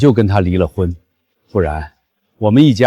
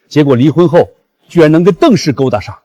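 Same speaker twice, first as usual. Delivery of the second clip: raised, indignant complaint; loud grumbling; 6 semitones higher, 4 dB louder than usual. Acted. Father-in-law griping about ex-son-in-law's new backer, loud grumbling. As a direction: gripe indignantly, pitch climbing as you go. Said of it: "小声点吧".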